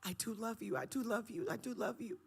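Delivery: high pleading; pleading with God. Retold or imitated. Guest acting out pleading her love to God. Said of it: "I was like".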